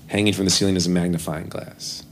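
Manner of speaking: ordinary talk, nothing marked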